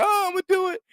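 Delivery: very high whiny fake wail; mock crying. Mocking a man threatening his girlfriend, crying. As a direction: imitate a tearful threat, hammed up. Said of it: "and he's crying like".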